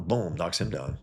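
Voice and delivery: ordinary delivery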